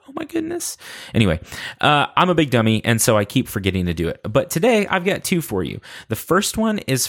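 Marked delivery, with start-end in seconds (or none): 0.1-0.8 very high, soft squeak of shock; mock scandalized squeal. Reacting to his own silly insult, a high mock squeal.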